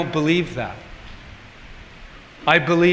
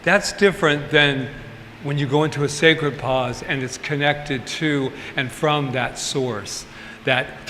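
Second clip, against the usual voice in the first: normal talking voice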